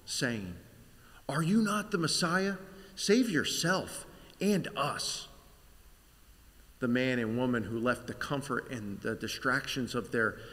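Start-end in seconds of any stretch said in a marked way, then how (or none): 1.3-5.3 scoffing. Acted. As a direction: jeer at him with scorn. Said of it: "He's scoffing".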